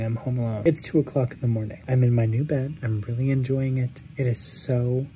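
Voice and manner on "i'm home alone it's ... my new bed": usual speaking voice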